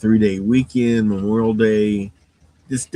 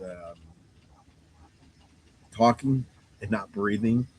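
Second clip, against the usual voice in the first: ordinary talk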